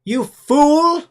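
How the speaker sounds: sudden high, loud shout of "fool"; mock yell. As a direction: bark it at the chatbot, hammed-up. Mocking the AI, yelling.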